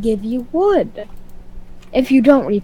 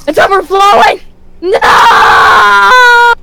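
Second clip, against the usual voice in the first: higher, louder hammed-up alarm; mock panic; 7 semitones higher, 6 dB louder, much slower than usual. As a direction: shout like the ship is failing. Panicking about overflowing meter.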